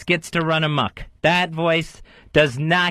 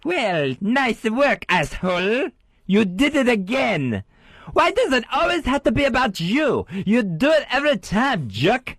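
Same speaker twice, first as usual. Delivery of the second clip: higher, mock French scolding voice; squeaky French accent; 6 semitones higher than usual. Shown as a demonstration. Repeating the inner-voice insults in a higher French accent. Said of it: "I want the voice higher, almost squeaky, and in a French accent".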